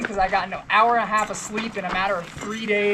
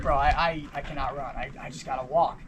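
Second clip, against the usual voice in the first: much lower, softer retold exhaustion; worn-out protest; 7 semitones lower, 5 dB quieter than usual. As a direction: plead with the pacer, acting broken. Exhausted runner refusing pacer's urging.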